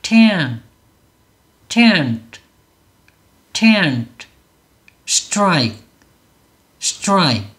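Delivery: tent and strike each as one falling syllable; English "tent" and "strike", one at a time; model pronunciation. Shown as a demonstration. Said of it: "다시 한번 해볼게요".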